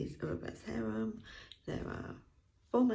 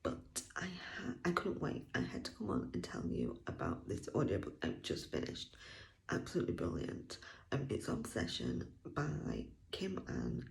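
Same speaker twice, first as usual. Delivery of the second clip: hushed whisper; 12 semitones lower, 3 dB quieter than usual. Real, not acted. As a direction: keep it to a breathy hush. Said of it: "because my child is in the other room".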